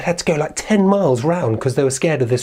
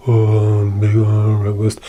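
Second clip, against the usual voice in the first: low monotone troll pondering; troll thinking; 4 semitones lower, much slower than usual. Acted. Troll considers a bigger breakfast, low pondering voice. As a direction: ponder slowly as a dim troll. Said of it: "And the troll again".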